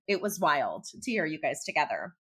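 usual speaking voice

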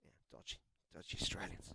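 whispered teasing jab; joking whisper. Acted. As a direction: breathe the insult out like a cheeky secret. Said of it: "I'm clearly joking".

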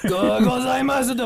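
high, hammed-up anime voice; exaggerated anime imitation; mocking mimicry. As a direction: parody a put-on theatrical Japanese voice. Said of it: "not us making fun of him".

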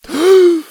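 sharp, high gasp of panic; loud gasp. A narrator's reading. Boy about to be sucked under, loud desperate gasp. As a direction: gulp one loud last breath. Last breath before going under.

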